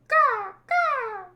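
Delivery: high, loud cawing; imitated bird calls. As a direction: caw like a jungle bird. Voicing jungle bird noises.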